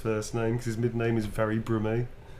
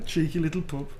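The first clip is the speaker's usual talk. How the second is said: higher, broad Birmingham tease; cheeky Brummie; 5 semitones higher than usual. Shown as a demonstration. Cheeky message for Kim in broad Brummie. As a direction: tease in Brummie, about to laugh. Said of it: "this is a cheeky, funny little message".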